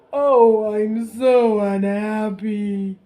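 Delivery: loud, slow wailing sob; hammed-up sobbing king. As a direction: blubber it out like a miserable king. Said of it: "he keeps sobbing".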